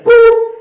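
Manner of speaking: high, loud imitated beep; short sung-out boop; voicemail beep. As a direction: make a piercing answering-machine beep. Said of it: "It's like".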